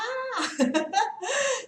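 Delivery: high bursting laugh; burst of laughter. Real, not acted. Teacher laughing at her own point to viewers, real laugh. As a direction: break into a real laugh mid-explanation.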